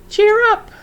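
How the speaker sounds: very high, loud squawk; bird squawk. A narrator's reading. Wiffle bird cutting through the din, squawking. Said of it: "she squawked".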